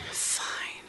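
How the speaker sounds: a sulky whispered fine; pouting. Retold or imitated. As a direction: give in grudgingly under your breath.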